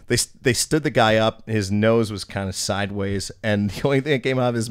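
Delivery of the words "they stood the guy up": ordinary delivery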